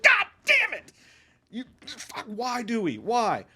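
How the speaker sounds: high yell falling to a broken plea; shouted frustration then despair; anguished outburst. Retold or imitated. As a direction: cry out at the screen. Outburst when phone rings.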